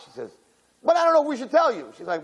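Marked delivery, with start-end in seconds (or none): 0.8-1.9 put-on teasing girlfriend. Girls teasing bride in limo.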